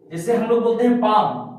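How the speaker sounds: ordinary talk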